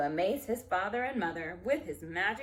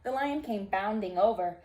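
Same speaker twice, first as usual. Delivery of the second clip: ordinary delivery, nothing marked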